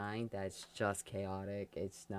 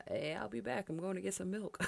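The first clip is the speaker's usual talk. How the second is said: higher, airy fake-casual excuse; mock sneaky excuse; 10 semitones higher than usual. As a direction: play the liar slipping out.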